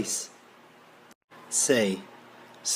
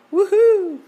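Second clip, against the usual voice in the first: high, loud whoop; excited cheer; 6 dB louder than usual. Acted. Family arriving in Oregon, high excited cheer.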